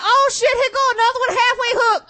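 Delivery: high, loud mocking fish. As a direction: taunt as a smug fish. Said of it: "They're like".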